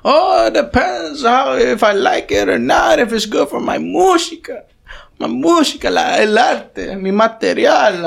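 very high, drawn-out parody of the roommate; imitated roommate. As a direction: mimic the self-important musician in slow, high Spanish-English. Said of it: "He's like".

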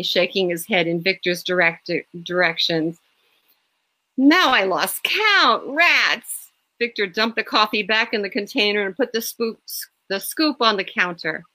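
plain reading voice

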